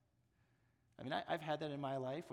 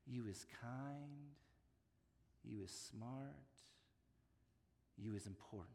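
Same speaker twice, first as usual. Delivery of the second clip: tender nanny reassurance; 6 semitones lower, 11 dB quieter than usual. Acted. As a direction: gently tell the child what she is worth. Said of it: "Abilene says to Mae".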